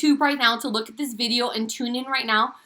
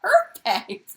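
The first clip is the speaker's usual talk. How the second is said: high-pitched chirped approval; hammed-up high agreement; mock delighted reply; 8 semitones higher than usual. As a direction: pitch it way up, as if thrilled. Acts out her delighted reply.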